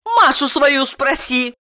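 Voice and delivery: high-pitched angry wife; angry cry. A narrator's reading. Wife snaps back at her husband about their daughter, angrily. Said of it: "зло крикнула Дарья".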